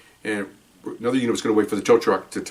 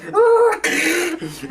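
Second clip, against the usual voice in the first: a high burst of real laughter. Real, not acted. Laugh alone on line.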